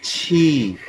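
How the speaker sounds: छी, breathy ch sound; Chinese q as chhi. Shown as a demonstration. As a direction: say छी with an aspirated ch and ee. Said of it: "क्यू को हम पढ़ते हैं".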